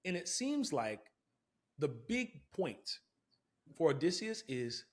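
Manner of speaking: ordinary talk, nothing marked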